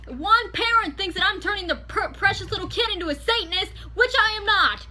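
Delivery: high, loud cussing shout; yelling. Retold or imitated. Student screaming back at the principal. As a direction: scream the refusal. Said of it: "I actually was cussing at this point".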